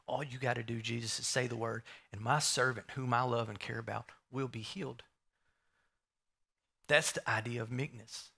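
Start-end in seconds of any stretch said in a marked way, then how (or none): 0.1-5.0 soft, low and humble; humbled plea. Acted. Centurion laid bare before Jesus for his servant, humbly. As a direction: lower the voice into a quiet, humble appeal. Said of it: "The centurion is completely humbled".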